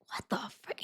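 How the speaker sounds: under-the-breath what the frick; hushed shocked aside; whispered disbelief. Retold or imitated. Reacting in disbelief.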